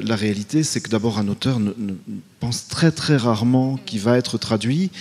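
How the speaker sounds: normal talking voice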